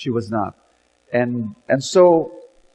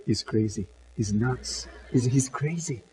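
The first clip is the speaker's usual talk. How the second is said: softer gossiping aside among relatives; quiet murmuring; 5 semitones lower, 11 dB quieter than usual. Acted. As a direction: murmur it behind a hand. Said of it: "quiet murmuring".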